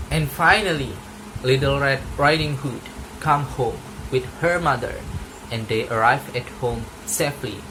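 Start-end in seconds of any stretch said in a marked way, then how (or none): none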